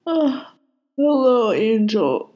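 sleepy voice through a yawn. Acted. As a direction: say it mid-yawn, drowsy. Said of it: "he said on a yawn".

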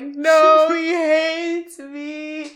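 high, drawn-out mock despair; mortified wail. She recalls her crush's peck, mock mortified wail. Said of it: "I was mortified".

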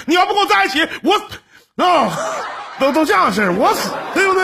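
夸张模仿要死要活. Retold or imitated. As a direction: ham up the desperate lover, cracking up. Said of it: "我们那个年代多单纯呐". (笑着尖声学男人喊要死)

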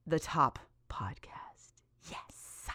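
hushed, slow whisper of triumph; voiceless, secretly thrilled celebration; awed whisper. Real, not acted. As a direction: whisper it as if savouring it. Savouring being fans' top podcast.

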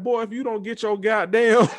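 high, loud mock scolding; retold cussing out.

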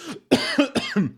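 rough cough clearing the throat; choking cough. Real, not acted. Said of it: "Jetzt hab ich ihn verschluckt".

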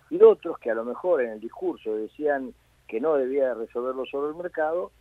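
normal talking voice

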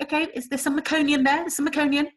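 high, brisk clinical tone; midwife voice. Retold or imitated. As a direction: mimic the midwife. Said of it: "she was like".

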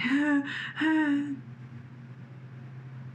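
long breathy high sigh, no voice; airy whispered sigh of going empty; blank exhale. Retold or imitated. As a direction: sigh out into nothing. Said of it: "I'm just like".

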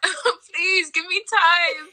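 high, slow, whiny begging; teasing whine begging friends for time; mock plea. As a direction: drag it out like a sob.

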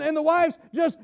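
usual speaking voice

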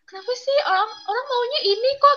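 imitating her younger self whining at her mother; whiny complaint. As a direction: complain like a sulky teen. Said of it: "terus kayak".

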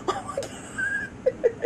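high, slow words squeezed out through laughter; laughing. Real, not acted. Teller laughing helplessly at the memory, laughing. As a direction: let the laugh take over the words.

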